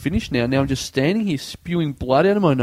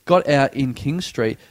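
usual speaking voice

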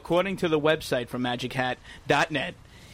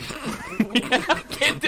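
breaking into slow laughter; laughing; 8 semitones higher, much slower than usual. Real, not acted. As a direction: crack up mid-line.

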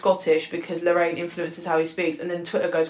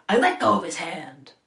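Jack's odd delivery; 3 semitones lower than usual. Retold or imitated. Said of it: "It's the way he says it".

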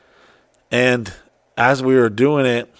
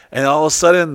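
ordinary talk

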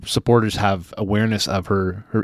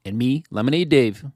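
bright, upbeat higher announcer read; chipper 1950s ad; 4 semitones higher than usual. Acted. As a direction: sell it like a cheerful vintage radio spot. Sponsor ad read at show's end.

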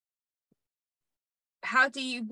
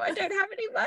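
panicky high voice; 10 semitones higher, much faster than usual. Retold or imitated. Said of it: "It's like".

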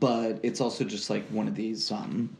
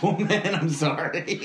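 talking through held-back laughter; fighting back the giggles; stifled giggles; 6 semitones higher, 4 dB louder than usual. Real, not acted. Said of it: "I'm trying not to laugh".

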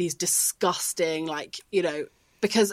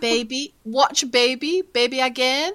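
put-on higher, rising little-kid requests; lightly voiced toddler, pitched up in short phrases; imitating toddler son; 6 semitones higher, 4 dB louder than usual. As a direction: mimic a small child asking to watch. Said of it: "He calls it baby".

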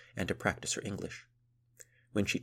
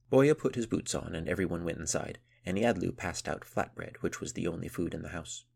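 plain reading voice

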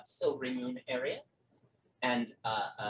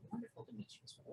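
much higher, much softer and very fast; squeaky echo; 18 dB quieter, much faster than usual. Acted. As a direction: rattle it off in a tiny squeak. Second NPC echoes.

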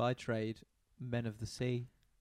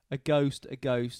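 retold panicked shout; 5 dB louder, slower than usual. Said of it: "everyone starts shouting".

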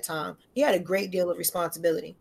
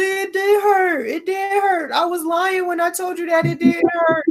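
high, loud whiny sob-shout; imitating a crying child's loud protest; mock crying daughter; 10 semitones higher, 6 dB louder than usual. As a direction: wail like a hurt kid.

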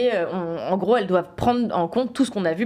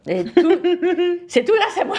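laughing; 9 semitones higher, 4 dB louder than usual. Real, not acted. Jokes she made intern succeed.

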